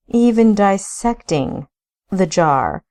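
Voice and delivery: dissecting leaned on hard; stress on dissecting. Acted. Brother mocking the search, stress on dissecting. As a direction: weigh down the word dissecting. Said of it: "He put special emphasis on the word dissecting".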